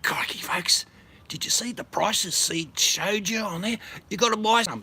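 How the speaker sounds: hushed then loud Australian crocodile-hunter voice; Australian showman. Pretend. Parody Aussie presenter voice.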